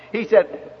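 usual speaking voice

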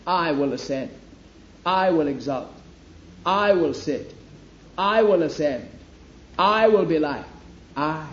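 each "I" in "I will" hit hard and held; stress on I. Shown as a demonstration. Preacher showing the congregation where the emphasis lay, stress on "I". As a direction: punch "I" every time. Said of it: "Where's the emphasis?".

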